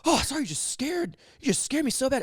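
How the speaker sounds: very high-pitched imitation of a startled guest; over-excited apology, imitated; Harry Carey. Startled guest explaining himself to host, Harry Carey energy. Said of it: "Imagine Harry Carey".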